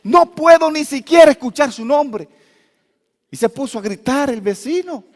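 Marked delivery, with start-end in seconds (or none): 0.0-2.3 high, loud panicked yell; neighbor's scream. Retold or imitated. Neighbor hearing of the snake, loud panicked yell. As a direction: shriek at the very word snake. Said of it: "Y él tiró un grito".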